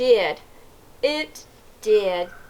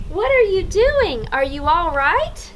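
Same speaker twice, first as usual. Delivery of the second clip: worried call; 7 semitones higher, 4 dB louder than usual. A narrator's reading. Boy worried calling.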